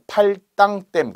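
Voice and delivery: 팔당댐 slowly, as "pal-ttang-ttaem"; tensed consonant. Shown as a demonstration. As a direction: harden the 당 in 팔당댐 to 땅, drawn out slowly. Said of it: "만약에 발음의 문제".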